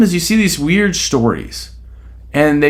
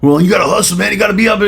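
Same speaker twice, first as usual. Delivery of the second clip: mock hustle-guru pep talk, a bit louder; lightly hammed-up hustle coach; hype influencer; 6 semitones higher, 3 dB louder, much faster than usual. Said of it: "look at it and".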